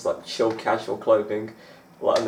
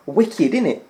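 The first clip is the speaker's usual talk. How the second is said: put-on higher, excited kid with a cool toy; little kid; 8 semitones higher, 4 dB louder than usual. Mimicking a kid with a cool toy in a higher, excited voice. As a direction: squeal it like a kid with a new toy. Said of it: "Sound like a little kid".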